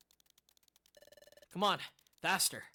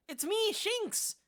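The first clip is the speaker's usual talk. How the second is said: ordinary delivery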